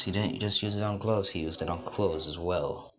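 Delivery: ordinary delivery, nothing marked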